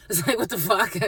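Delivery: real laugh, incredulous; laughing disbelief at the absurdity; laughing what the fuck. Real, not acted.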